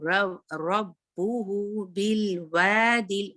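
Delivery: slow chanted recitation; slow melodic Quran recitation. Shown as a demonstration. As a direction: recite it slowly, doubling the ba. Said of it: "बा टू times ही अदा हो".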